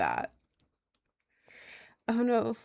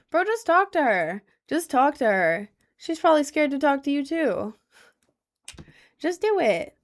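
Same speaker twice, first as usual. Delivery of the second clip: high, loud hype-up push; loud urging; 6 semitones higher, 5 dB louder than usual. Real, not acted. To a shy viewer about the barista, loud hype. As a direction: cheer the viewer on to just do it.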